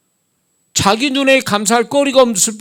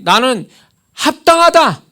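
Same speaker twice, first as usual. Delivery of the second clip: higher, louder, emphatic self-justifying claim; self-righteous insistence; 7 semitones higher, 6 dB louder than usual. Acted. Preacher voices a complainer justifying themselves, self-righteous insistence. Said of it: "그렇게 생각하거든요".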